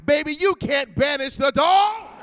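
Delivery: high, loud diva defiance; cocky proclamation. Retold or imitated. Contestant walking in sure of a win, cocky shout. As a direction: crow it like you cannot lose. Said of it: "I walked in like".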